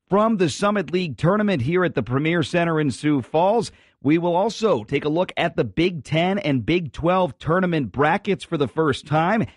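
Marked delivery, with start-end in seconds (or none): none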